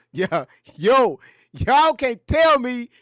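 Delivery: usual speaking voice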